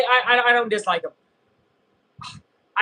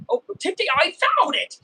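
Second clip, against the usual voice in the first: high-pitched exasperated cry; hammed-up squeal of dismay; mock overreaction; 9 semitones higher than usual. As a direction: play up the frustration, voice jumping high. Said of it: "I'm becoming him".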